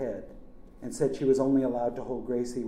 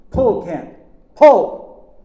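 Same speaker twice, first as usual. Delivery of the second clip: very loud yell, rising higher; desperate shouting; 9 semitones higher, 20 dB louder, much slower than usual. A narrator's reading. Uncle begging nephew to pull, screaming. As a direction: scream the order, more desperate each time.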